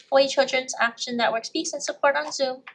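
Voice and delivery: ordinary delivery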